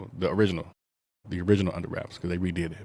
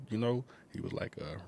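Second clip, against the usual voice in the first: ordinary delivery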